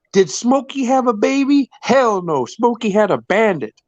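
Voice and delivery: high, sulky talking-dog voice; cartoon dog. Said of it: "And he goes".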